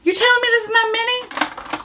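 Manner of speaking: high-pitched, exaggerated disbelief; mock incredulity. Mock disbelief at lipstick size.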